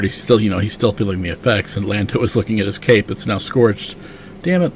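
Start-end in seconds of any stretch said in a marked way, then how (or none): 4.4-4.7 high, exasperated exclamation; annoyed curse. Retold or imitated. Lando seeing his scorched cape, a high exasperated curse. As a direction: groan over the ruined cape.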